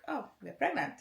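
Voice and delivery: mock announcement. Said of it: "It was just like".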